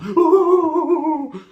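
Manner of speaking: high, loud mock wail; long howl at a bump in the road; pained howl. Acts pain at every bump.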